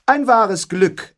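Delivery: high, slow and haughty. A narrator's reading. Beatrice haughtily dismisses Benedikt, high and slow. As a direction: look down your nose, drawn out. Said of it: "erwiderte Beatrice hochnäsig".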